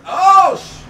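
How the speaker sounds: sudden high loud yelp of surprise; jolted by a crash mid-race; startled yelp. Real, not acted.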